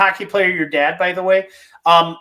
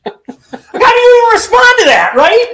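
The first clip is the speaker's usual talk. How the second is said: much higher and louder, shouting through a laugh; laughing disbelief; 7 dB louder than usual. Real, not acted. Host cracking up at the hockey star's cocky answer, shouting through laughter. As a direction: burst out in amazed laughter.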